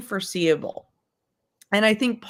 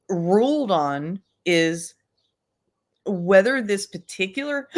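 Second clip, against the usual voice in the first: ordinary talk, nothing marked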